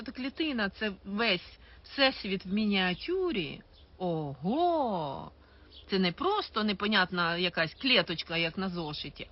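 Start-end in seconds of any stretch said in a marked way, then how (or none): 4.0-5.3 mock amazement.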